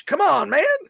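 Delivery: high, loud, playful exasperation; a hammed-up whine of protest; mock protest. Mock protest over Bush name.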